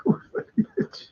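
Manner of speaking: repeats it through a breathy laugh; amused laughing repeat. Real, not acted. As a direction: giggle the words out.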